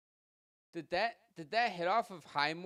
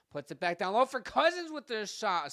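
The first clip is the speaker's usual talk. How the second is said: excited call; 6 semitones higher, 6 dB louder than usual. Real, not acted. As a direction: voice jumps up and louder on the shot. Shot on net in play-by-play.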